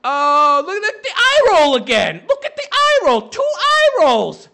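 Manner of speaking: high, loud, gleeful jeering; gleeful jeering at her eye roll; laughing mockery. Real, not acted. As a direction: crow over every eye roll. Mocking the eye rolls.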